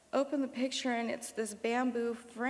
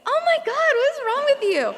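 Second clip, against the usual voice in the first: much higher and louder, laughing protest; laughing disbelief; 13 dB louder than usual. Retold or imitated. Scolding dad for his joke, much higher, louder, laughing. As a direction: shriek it in mock outrage.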